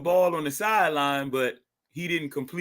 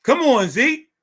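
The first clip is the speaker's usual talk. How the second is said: higher, louder urging call; urging cheer; 8 semitones higher, 5 dB louder than usual. Real, not acted. Fan urging Zeke to get the first down, loud high call. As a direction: push him with your voice.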